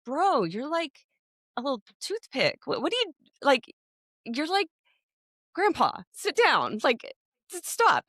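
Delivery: high-pitched, incredulous scolding; exasperated mock telling-off; mock exasperation. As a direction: talk down to a ridiculous tough guy. Mock retort to the tough-guy video.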